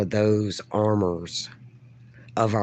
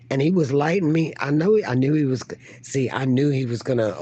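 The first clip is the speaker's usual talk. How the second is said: excited; 4 semitones higher, faster than usual. Real, not acted. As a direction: rush it out, worked up. Excited retelling of a live argument.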